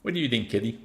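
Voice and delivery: normal talking voice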